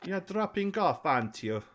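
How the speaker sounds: lightly high, wary headmistress voice; a hint of a suspicious matron checking. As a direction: headmistress asking the party if they're dropping off, lightly voiced, wary. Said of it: "the headmistress kind of looks at you and she says".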